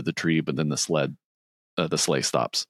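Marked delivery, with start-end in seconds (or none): none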